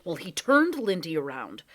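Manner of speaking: usual speaking voice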